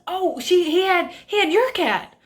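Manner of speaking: high, halting imitation; mock flustered neighbor. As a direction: mimic a neighbor backtracking in a high voice.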